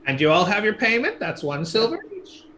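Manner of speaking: lightly high crone asking payment; a hint of a creaky ferrywoman; old lady voice. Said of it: "this old lady says".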